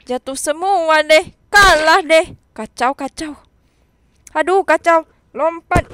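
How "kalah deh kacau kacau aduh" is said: normal talking voice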